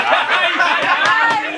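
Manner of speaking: shrieking burst of laughter. Real, not acted. Laughs at own punchline.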